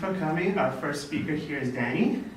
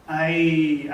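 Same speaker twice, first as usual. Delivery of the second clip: usual speaking voice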